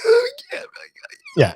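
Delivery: high loud gasping squeal; imitating a fan losing it; mock fangirl shriek. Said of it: "I wasn't like".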